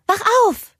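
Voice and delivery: put-on high, loud little-girl voice; excited child demanding her sister wake up; calling out. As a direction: urge her sister awake. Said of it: "rief sie".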